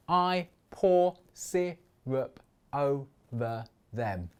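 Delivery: "I pour syrup over them" in seven slow, separate beats; slow, spaced-out "I pour syrup over them"; syllable by syllable. Shown as a demonstration. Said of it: "I only need seven".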